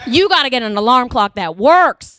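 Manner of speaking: drawled Texas scolding; hammed-up Southern drawl; southern accent. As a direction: scold like a stern Southern coach. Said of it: "in my southern accent".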